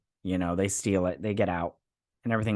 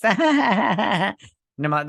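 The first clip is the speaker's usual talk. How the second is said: higher, louder, slow laugh through the words; 7 semitones higher, 5 dB louder, much slower than usual. Real, not acted. Laughing at the show as she retells it. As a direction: laugh while setting up your own comment.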